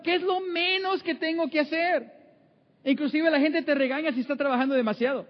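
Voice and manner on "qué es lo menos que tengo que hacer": a higher, mocking voice for the slacker; mock the idle government worker's thought; lazy worker. Preacher mocking an idle government worker's thinking, higher mock voice. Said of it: "Porque está pensando".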